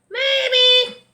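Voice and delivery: very high, loud bird; loud, high-pitched bird character. A narrator's reading. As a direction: squawk it out like a cartoon bird. Bird answers Franklin in character.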